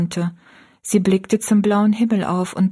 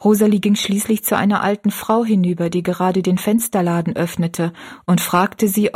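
ordinary delivery, nothing marked

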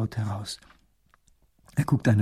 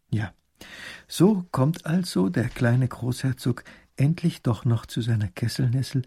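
normal talking voice

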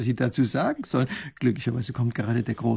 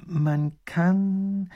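drawn-out higher-pitched musing; slow, pondering; 6 semitones higher than usual. A narrator's reading. Professor forming an idea aloud, slow and pondering. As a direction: think while speaking. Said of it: "sagt Professor Pfiffikus langsam und überlegend".

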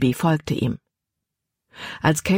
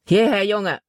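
lightly voiced mean slave owner, pitched up; mildly mean; 4 semitones higher than usual. A narrator's reading. New owner ordering his slave over, mildly mean. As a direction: order him over with a touch of meanness. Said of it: "Sogar seine Stimme klang gemein".

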